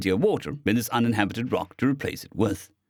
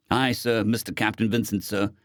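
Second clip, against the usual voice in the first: ordinary delivery, nothing marked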